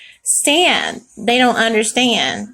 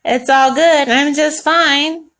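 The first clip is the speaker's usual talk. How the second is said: higher, louder show of being fine; mock royal grandness; 4 semitones higher, 4 dB louder, slower than usual. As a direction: put on a grand false front, drawing it out. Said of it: "acting just as royal and just as grand".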